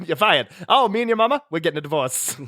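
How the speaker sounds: high bright announcer. Acted. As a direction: announce the divorce cheerfully. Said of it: "everyone talks like an announcer".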